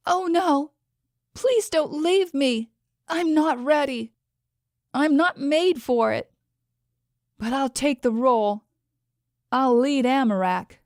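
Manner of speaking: a granddaughter begging him not to go; desperate plea. A narrator's reading. Alina begs dying Pukak not to leave her, anguished.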